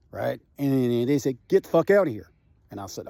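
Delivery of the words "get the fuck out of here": a gruff order, somewhat louder; retold order. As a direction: order him off the scene. Firefighter repeats the order.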